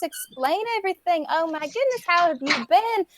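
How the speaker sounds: put-on gushing old busybody greeting a returning girl; chatty old lady. GM voices old Missus Tucker gushing over Susanna, mildly high. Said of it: "she talks quite loud".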